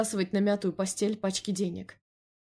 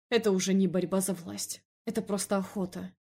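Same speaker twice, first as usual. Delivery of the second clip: ordinary delivery, nothing marked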